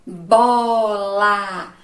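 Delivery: "Bola" said loud with both syllables drawn out; syllable-by-syllable demo. Shown as a demonstration. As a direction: stretch "Bola" into two long, loud syllables. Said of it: "pode pronunciar até de voz alta".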